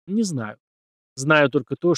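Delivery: ordinary delivery, nothing marked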